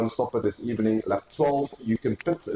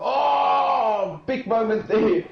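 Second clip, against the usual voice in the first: sudden high gasp of surprise; surprised; 11 semitones higher, 3 dB louder, much slower than usual. Real, not acted. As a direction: caught off guard by a spin. Sudden incident on track.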